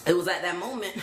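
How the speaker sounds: normal talking voice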